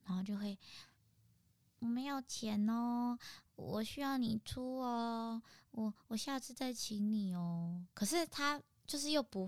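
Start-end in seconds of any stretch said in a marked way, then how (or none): 1.8-7.8 put-on slow, soft, babyish plea; pitiful pleading. Girl begging her friend to pay, slow and pitiful. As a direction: plead slowly and softly with puppy eyes. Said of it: "露出一个水汪汪的眼神".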